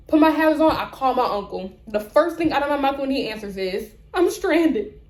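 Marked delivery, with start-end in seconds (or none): 4.1-5.0 higher-pitched mock-panicked cry for help; retold panic. Stranded driver calling her uncle for help, retold panic. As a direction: replay a touch of panic, pitched up. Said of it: "sense the panic in my voice".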